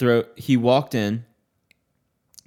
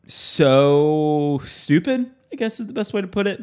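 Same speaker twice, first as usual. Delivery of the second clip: exasperated; 5 semitones higher, slower than usual. Real, not acted. Ranting about stupid customer questions.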